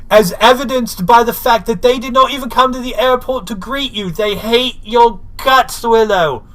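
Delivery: high, loud snooty Giles impression; mock Giles. Host mimics Giles gloating to Willow, high and loud. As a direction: lay it on thick as the pompous mentor. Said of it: "And he's like".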